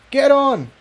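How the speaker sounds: high, urgent call; a high urgent shout. A narrator's reading. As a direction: hurry her onto the platform. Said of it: "Jigsaw called".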